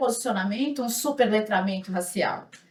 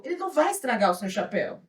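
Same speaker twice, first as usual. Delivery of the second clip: ordinary talk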